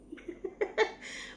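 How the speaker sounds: short high chuckle; amused giggle at her own remark; laughing. Real, not acted. Commentator tickled by her own Punjabi jibe, chuckling.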